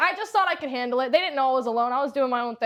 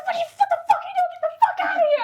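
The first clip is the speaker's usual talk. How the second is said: high, fast, outraged mock yell; retold yelling; 3 dB louder, much faster than usual. Storyteller re-enacting yelling from her car window at the man, outraged. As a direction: re-enact yelling at someone, pitch shooting up. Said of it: "I started yelling like he's a dog".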